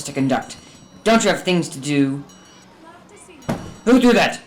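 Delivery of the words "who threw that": sharp, loud, high demand; angry shout. Acted. Scrooge to the snowball thrower, angry shout. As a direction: bark it at the street children.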